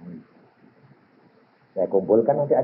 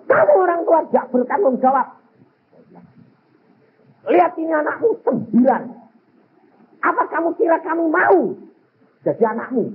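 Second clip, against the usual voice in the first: raised, pitched-up retold scolding; 13 semitones higher than usual. Son rebuking quarrelling parents, mild retold anger. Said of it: "Saya marahin luar biasa".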